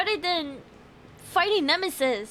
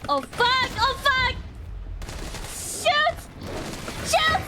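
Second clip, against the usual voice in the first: high panicked yelling; yelling while running away; 10 semitones higher than usual. Real, not acted. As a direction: scream while fleeing danger.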